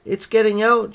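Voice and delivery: high, loud warning cry; alarmed shout. A narrator's reading. Missus Thomas sees the kitten escaping, shouting. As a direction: raise the alarm, pitch right up. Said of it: "shouted Missus Thomas".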